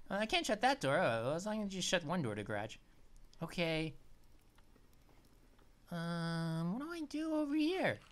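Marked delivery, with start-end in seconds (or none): none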